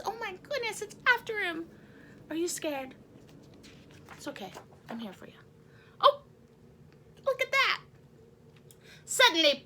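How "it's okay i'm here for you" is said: soft reassurance. Acted. Comforting scared kids.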